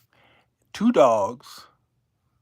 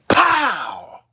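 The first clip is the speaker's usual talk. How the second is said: higher, louder blast; landing impact; 8 semitones higher, 7 dB louder than usual. Retold or imitated. The plane slamming the runway, loud blast. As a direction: slam out the impact noise.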